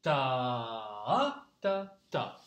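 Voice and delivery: long "Ta" rising on the second pulse, then short "ta, ta"; three-beat rhythm. Shown as a demonstration. Teacher shows three beats, dotted rhythm demo. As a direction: hold the first "Ta" low, lift it mid-note, then two short "ta". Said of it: "trois temps comme ceci".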